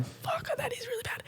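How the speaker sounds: hushed, breath-only private thought; whispered aside. Acted. Realising privately while someone is angry at you, whispered. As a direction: whisper it to yourself.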